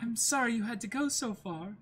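put-on high, polite girl's voice; young lady Kaya. Kaya thanking her butler, high and polite. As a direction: thank the servant sweetly. Reading Kaya's line in voice.

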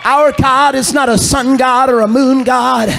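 loud, high, rising preacher's cry; full-force preaching. Acted. Preacher rallying the congregation, loud and high. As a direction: preach it out with mounting fire.

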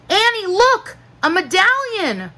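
high, eager call; high-pitched call for attention. A narrator's reading. Boy calling sister to look.

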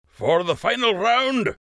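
Master Fletcher announcing. A narrator's reading. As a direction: hold the crowd's attention. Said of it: "Master Fletcher said".

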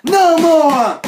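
high, loud protest; angry shout. Acted. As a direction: shout it in outrage. Raging at hackers.

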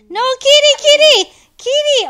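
very loud, high yelling; high alarmed cry; panicked shout. Real, not acted. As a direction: shout in game panic, repeating urgently. Panics as the monster attacks.

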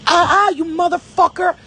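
high, loud mocking curse; hammed-up angry swearing; mock angry cursing. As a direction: imitate a furious cusser. Mocking someone's angry outburst.

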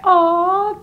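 ordinary talk

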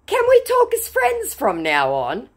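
high, loud put-on plea; pleading scammer. Scammer asking his accuser for friendship, high mock plea. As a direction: imitate a hopeful scammer. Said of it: "he said".

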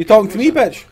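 angry. Acted. Confronting a stranger.

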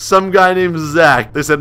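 normal talking voice

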